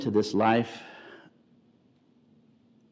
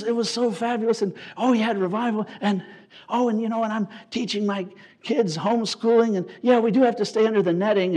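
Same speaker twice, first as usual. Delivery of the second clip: higher, bubbly church-lady chatter; gushing missionary wife; 10 semitones higher, 5 dB louder than usual. Retold or imitated. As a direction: rattle on like a beaming, overeager wife. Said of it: "she goes".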